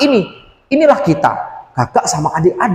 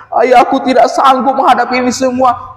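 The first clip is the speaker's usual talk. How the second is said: mock crying child; 9 semitones higher, 3 dB louder than usual. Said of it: "Dan malah dia menangis".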